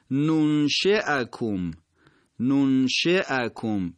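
ننشئکم slow, with a clear fatha hamza in the middle; slow, exact ننشئکم; medial hamza demo. Shown as a demonstration.

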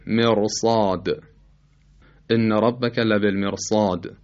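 slow مرصاد with a thick, full r; heavy ra. Shown as a demonstration. Teacher giving the example, heavy r in مرصاد. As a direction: recite لبالمرصاد, r heavy before the saad.

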